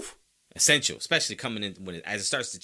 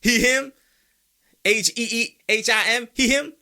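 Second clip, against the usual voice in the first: loud crowd hype, climbing higher; hyped-up fans; 4 semitones higher, 6 dB louder than usual. Acted. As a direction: build from amazed whoa to high, loud chanting. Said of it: "everybody to say".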